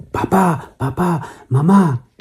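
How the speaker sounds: high, frightened calling out for papá and mamá; scared cry for parents. Acted. Little girls waking at midnight, calling parents in fright. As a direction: call out like a terrified little girl.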